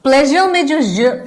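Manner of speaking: normal talking voice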